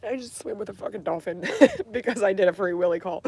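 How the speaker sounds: laughing through tears; giddy laugh mid-sentence. Real, not acted. Laughing while telling it.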